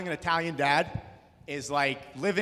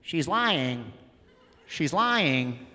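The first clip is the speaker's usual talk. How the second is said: put-on girl in back; much slower than usual. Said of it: "you hear".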